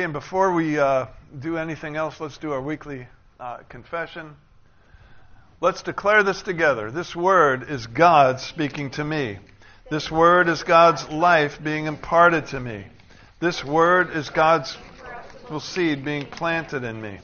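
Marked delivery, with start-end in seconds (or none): 5.6-17.2 loud, measured recitation; congregational declaration. Acted. Preacher leading the congregation in weekly confession, declaring loudly. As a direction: proclaim each line firmly, stressing the key words.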